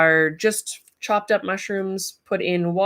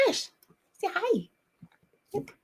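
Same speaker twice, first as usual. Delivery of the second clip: much higher coaxing call; high, excited come-here voice; calling the puppy; 14 semitones higher, 7 dB quieter than usual. Real, not acted. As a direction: coax the dog over to the camera. Coaxing dog.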